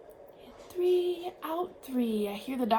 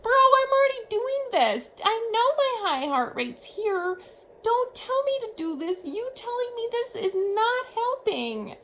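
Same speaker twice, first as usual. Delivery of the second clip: pitched way up in frustrated disbelief; exasperated high rant; 8 semitones higher than usual. Retold or imitated. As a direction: protest shrilly at the doctor, much higher. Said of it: "be like".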